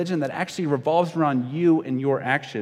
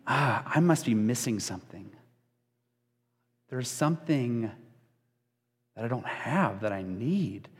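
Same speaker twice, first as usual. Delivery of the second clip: softer, halting acted self-doubt; mildly deflated, with long pauses; insecure; 3 semitones lower, 7 dB quieter than usual. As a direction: quietly worry that you are lacking. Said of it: "you start to feel insecure".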